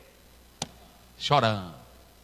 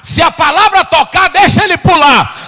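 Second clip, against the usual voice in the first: louder and louder exhortation; rising preaching; 5 semitones higher, 12 dB louder than usual. Acted. Preacher urging the church, building louder. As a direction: build the call to a peak.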